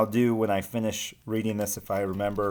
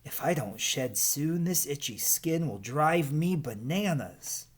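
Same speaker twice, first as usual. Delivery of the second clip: whining Aggie; 6 semitones higher than usual. Acted. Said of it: "whined Aggie".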